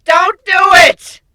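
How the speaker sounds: high, loud desperate yell of warning; frantic shout. A narrator's reading. Nightmare bursts in shouting at Griffin to resist. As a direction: scream at him to stop. Tug of war between voices.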